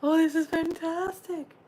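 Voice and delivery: high, loud burst of delight; thrilled exclamation over a card. Real, not acted.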